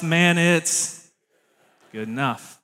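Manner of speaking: tired parent giving up; resigned sigh. Retold or imitated. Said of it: "you just say to yourself".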